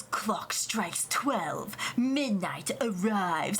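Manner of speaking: low, very slow, drawn-out imitation of a dramatic voice; mock-dramatic slow midnight voice; ominous announcer imitation. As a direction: intone it slowly like a spooky countdown. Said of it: "this guy goes".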